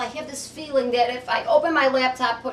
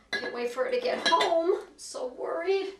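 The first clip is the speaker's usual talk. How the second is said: normal talking voice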